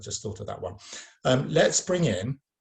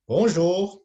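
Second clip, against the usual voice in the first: higher, louder puppet Bonjour; puppet voice greeting; 7 semitones higher, 8 dB louder than usual. Shown as a demonstration. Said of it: "You're gonna say".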